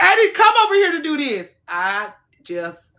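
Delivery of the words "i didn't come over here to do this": mock tearful friend. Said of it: "she was like".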